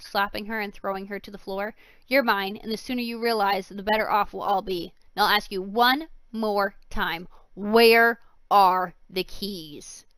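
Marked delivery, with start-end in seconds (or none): 2.1-10.1 menacing. Acted. Villain threatens fallen girl.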